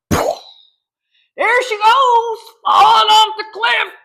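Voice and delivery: high, loud hammed-up wail of doom; mock wailing cry. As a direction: wail it like a melodramatic doomsayer. Mocking the menopause panic.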